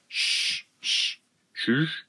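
whispered shushes; soft hissed shh; hushing. Acted. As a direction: shush someone urgently. Animals hush the child.

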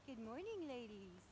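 quiet murmured good morning; soft greeting. Real, not acted. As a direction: drop the voice to a hush. Soft greeting right after.

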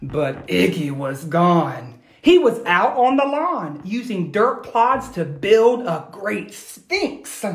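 put-on lively read-aloud to children; animated picture-book reading; storybook narration. A narrator's reading. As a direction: tell it like a bedtime story.

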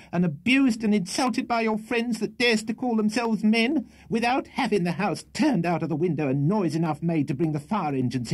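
very high, outraged scolding; shrill tirade. A narrator's reading. Landlady berating her lodger at two in the morning, shrill. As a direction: pour out the grievances shrilly. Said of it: "great shrillness and rapidity of utterance".

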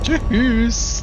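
high sing-song goodbye; mocking goodbye. Real, not acted. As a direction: taunt the beaten enemy. Mock farewell after kill. (spöttisches Tschüss)

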